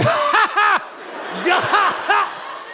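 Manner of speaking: high hooting cackle of laughter; imitating a belly laugh. The shopkeeper cracking up at the joke, high cackle. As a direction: burst into a huge helpless cackle. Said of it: "Se entró a carcajear de risa".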